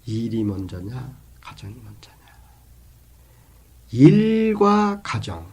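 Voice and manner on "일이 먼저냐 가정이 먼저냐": hushed, pensive repeat; softly repeated question. Real, not acted. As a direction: let the question sink away softly.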